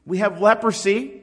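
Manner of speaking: high, loud mock call of a leper; yelling out. Leper warning people at a distance, yelling out. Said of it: "They would yell out".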